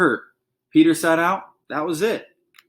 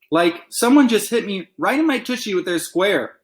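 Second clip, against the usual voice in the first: cried; 6 semitones higher, 3 dB louder than usual. A narrator's reading. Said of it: "Alexia cried".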